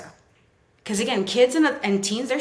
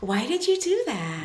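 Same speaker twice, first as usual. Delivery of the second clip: creepy questioning; 7 semitones higher than usual. Acted. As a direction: ask it creepily, pitched way up. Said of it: "I look creepy saying that".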